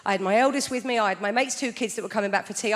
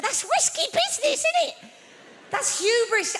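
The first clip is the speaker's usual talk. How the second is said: mock alarm; 13 semitones higher than usual. Mock horrified aside on risk.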